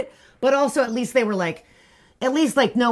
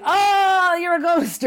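high, drawn-out gasp of recognition; imitating spooked surprise; mock astonishment; 9 semitones higher, much slower than usual. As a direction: voice a startled maid, pitched way up. Voices startled maid.

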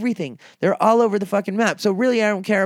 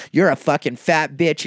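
ordinary talk, nothing marked

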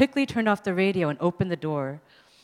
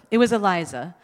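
ordinary delivery

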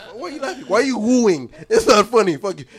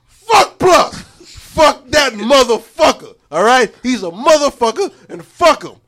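loud higher yell; 5 semitones higher, 9 dB louder, slower than usual. Real, not acted. Ranting at Plus during his final thoughts, yelling. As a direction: let it rip at him.

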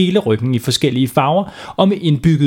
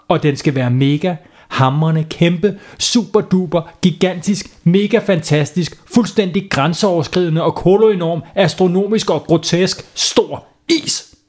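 each word pushed higher, slowing at the end; escalating demand; 6 semitones higher than usual. Acted. Girl demanding the biggest ice cream from the shopkeeper, building. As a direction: pile on the adjectives, building up. Laura piles up adjectives.

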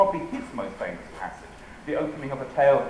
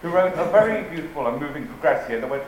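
ordinary talk, nothing marked

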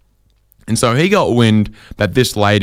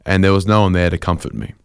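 usual speaking voice

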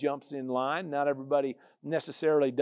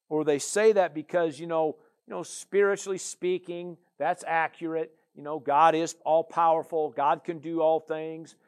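usual speaking voice

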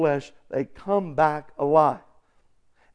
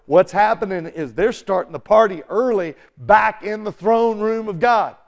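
ordinary talk, nothing marked

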